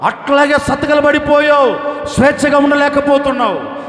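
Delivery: high, loud, driving sermon cadence; preaching at full force. Acted. Pastor preaching to the congregation about their burdens, fervent. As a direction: preach it hard to the crowd.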